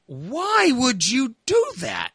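exasperated yell. Retold or imitated. Son yelling at his mother, slow high exasperated. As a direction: yell it at her, fed up. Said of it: "I tell my mother".

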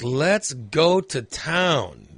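very slow, stretched-out and suggestive; drawn-out suggestive. Retold or imitated. Couple with the house to themselves, stretched slowly. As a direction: drag each word out with a wink. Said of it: "So we're like".

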